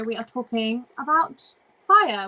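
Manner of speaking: ordinary delivery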